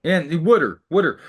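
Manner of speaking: loud, mocking Baltimore "wooder"; Baltimore accent. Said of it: "he would say wooder".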